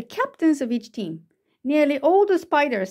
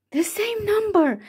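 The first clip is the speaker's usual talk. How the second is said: high-pitched cry of discovery; excited shout; 9 semitones higher than usual. A narrator's reading. Spinderella seeing both teams match, high excited cry. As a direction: exclaim it high with delight. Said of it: "shouted Cinderella".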